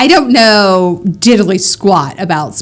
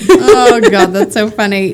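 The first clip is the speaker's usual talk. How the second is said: cracking up at a friend's story; laughing. Real, not acted. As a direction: laugh through the words.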